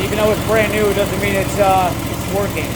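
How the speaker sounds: loud, high repeat over engine roar; shouting over engine. Real, not acted. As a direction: raise the voice over a motor. Repeats claim over running engine.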